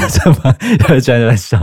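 giggling through the words; can't stop laughing while talking. Real, not acted. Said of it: "我们严肃一点". (边笑边说)

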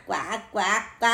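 duck quacks. A narrator's reading. Duck quacking between words.

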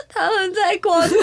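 high, sobbing complaint; retold crying. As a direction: sob it out like you did on the phone. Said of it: "我就哭了".

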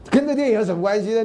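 laugh, then tease through it. Real, not acted. Teasing a student's odd reason.

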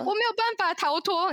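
high, hammed-up tearful wail; mock crying. 讲述那女生在星巴克哭诉时, 夸张的假哭腔. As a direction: melodramatic schoolgirl sobbing it out. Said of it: "还哭".

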